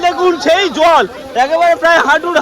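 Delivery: ordinary talk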